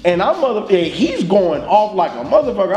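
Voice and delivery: ordinary talk, nothing marked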